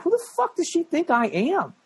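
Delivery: high, disbelieving squeak; retold inner disbelief; incredulous inner voice. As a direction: pitch it up in mock outrage. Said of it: "in my head, I'm like".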